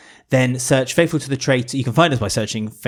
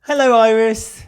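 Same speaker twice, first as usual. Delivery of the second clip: higher, slow and gentle hello; 9 semitones higher, 3 dB louder, slower than usual. Real, not acted. Podcast host greeting a listener's young daughter, gently. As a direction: greet her kindly, drawn out and bright. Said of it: "please say hello to my daughter, Iris".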